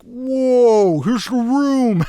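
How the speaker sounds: high, slow, overblown awe at a huge room; mock wonder. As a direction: gasp it out like the room itself is showing off. Mocking a big roomy reverb sound.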